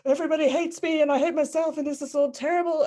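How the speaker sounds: high, fast, loud mock wail of despair; crying. Retelling her own backyard breakdown, high hurried mock sobbing. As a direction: spill it out like a sobbing meltdown, half-hammed. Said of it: "in the backyard crying".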